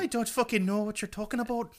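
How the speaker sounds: high, put-on Irish brogue; mock Irish accent. Host teasing co-host about sounding Irish, mock Irish accent. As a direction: rattle it off in a hammed-up Irish lilt. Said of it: "If I sound a little Irish".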